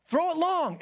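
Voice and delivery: mock excited yell; calling out. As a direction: call it across the field. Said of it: "they're like".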